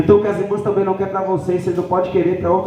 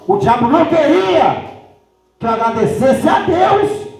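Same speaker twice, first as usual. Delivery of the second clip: acted fervent cry; 7 semitones higher than usual. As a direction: cry it out to the congregation, stretching the words. Preacher voices her triumphant cry. (brado fervoroso de pregador)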